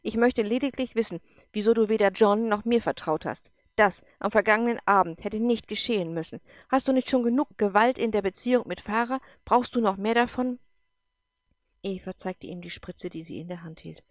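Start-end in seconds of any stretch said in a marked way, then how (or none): 0.0-10.6 louder, cutting and accusing; sharp tone. Acted. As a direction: scold him sharply, demanding answers. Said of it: "Evas Ton wurde scharf".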